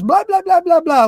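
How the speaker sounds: high sing-song blah blah; Peanuts adult voice. Retold or imitated. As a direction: mimic the wah-wah teacher sound. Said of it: "like Peanuts from Charlie Brown".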